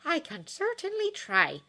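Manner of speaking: put-on high, slow grim old matron voice; determined but doubtful healer; grimly. Nurse taking on a hard cure, grim and slow. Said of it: "said Madame Pomfrey grimly".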